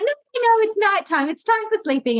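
high, insistent toy panda voice; squeaky panda insisting it's night. Retold or imitated. Mother voicing panda insisting it is nighttime, high and silly. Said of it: "and then I'd be the Pandy".

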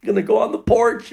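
high, pleading panic; acted-out anxious begging; freaking out. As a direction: plead your way out, frightened. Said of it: "freaking out".

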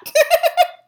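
loud high burst of laughter; real squealing laugh. Real, not acted. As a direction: crack up. Laughing after teasing.

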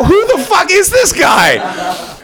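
very high, drawn-out incredulous cry; hammed-up high astonishment, stretched slow; astonished outburst. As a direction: squeal it in utter disbelief. Acted astonished reaction to driver.

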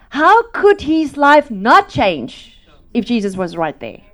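loud, high fiery preaching. Acted. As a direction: drive it home from the pulpit. Preaching at full force.

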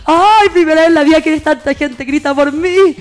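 mock starstruck delight. Pretending to be Hannah Montana to fans, slow thrilled gush. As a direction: savour fake fame like a pop star. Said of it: "Y yo".

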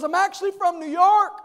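high, loud mock-fangirl voice; insistent fan. Young lady insists to pastor, high excited mock voice. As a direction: imitate an overeager young woman. Acting out the admirer.

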